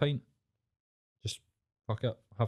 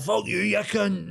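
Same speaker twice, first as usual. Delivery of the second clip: put-on drunk regular; 10 semitones higher, slower than usual. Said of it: "Guys like that".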